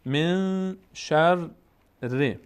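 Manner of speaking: «من شر ر» slowly, the n of «من» held in the nose; hidden noon. Shown as a demonstration. Teacher demonstrating ikhfa to students, «من» long and nasal. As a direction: draw out «من» nasally, then «شر ر» syllable by syllable.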